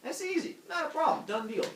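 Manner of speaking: high, dismissive cocky voice; cocky king. Acted. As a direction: brush it off like it's nothing. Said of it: "Minos is like".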